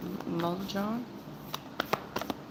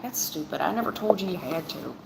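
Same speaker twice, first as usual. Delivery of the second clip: ordinary talk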